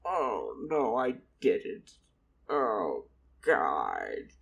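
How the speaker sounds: put-on low, slow old man's lament. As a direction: groan it out low as a dismayed old man. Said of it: "the old man was like".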